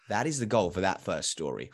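normal talking voice

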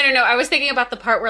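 normal talking voice

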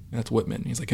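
usual speaking voice

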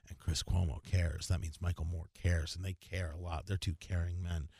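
low, soft sarcastic parody of caring men; mock caring low voice. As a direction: keep the hushed, fake-sincere low tone. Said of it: "speaking in a low voice like this".

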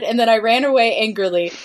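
ordinary delivery, nothing marked

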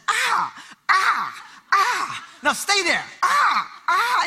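put-on high yelping "ah"s like a hurting little girl; mock the daughter's pained squeals; imitating child's cries. Daughter crying while hair brushed.

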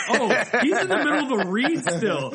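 high, loud, laughing realization; surprised. Retold or imitated. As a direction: catch yourself mid-ramble, laughing. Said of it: "he'll be like".

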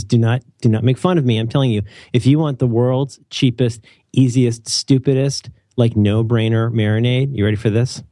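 usual speaking voice

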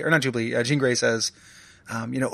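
normal talking voice

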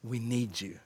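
soft, low, humble prayer; hushed plea. Retold or imitated. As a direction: whisper it in humility. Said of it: "they say".

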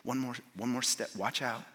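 very soft, low, hushed guiding voice; whispering friend. Acted. Friend leading blind man down the pool steps, whispering. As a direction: murmur careful directions, pausing between steps. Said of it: "hear the friend whispering to him".

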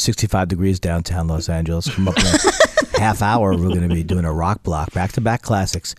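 smooth deep radio announcer. Pretend. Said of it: "this is my new radio voice".

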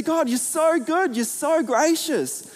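sincere worship voice, lifted and slow; earnest prayer. Pretend. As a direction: pray it up to God, slowly. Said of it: "I say".

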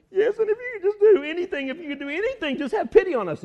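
high, loud, earnest plea; acted-out begging; urgent pleading. Acting the father's desperate plea.

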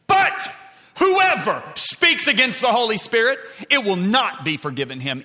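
explosive high start, loud proclamation easing slightly; full-force preaching. Acted. Preacher quoting Jesus's warning about the Holy Spirit, thundered proclamation. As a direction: hit the warning hard.

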